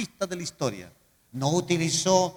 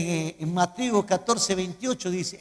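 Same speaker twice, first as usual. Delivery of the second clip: ordinary talk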